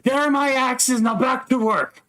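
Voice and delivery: high-pitched, brisk character voice; dwarf brothers. Acted. Forge brothers send workers back to work, high and brisk. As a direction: order everyone back in a high voice. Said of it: "To which the brothers are like".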